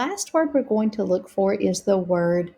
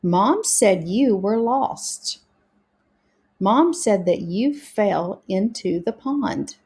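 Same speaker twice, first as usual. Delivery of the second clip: trying once more. Shown as a demonstration. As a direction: read the sentences carefully, word by word. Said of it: "let's try it one more time".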